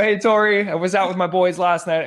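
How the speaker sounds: retold camera-ready pitch; on-camera opener. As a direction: launch brightly into a staged interview bit. Acts out his on-camera opener.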